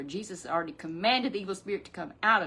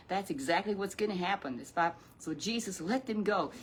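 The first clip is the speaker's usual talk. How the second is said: normal talking voice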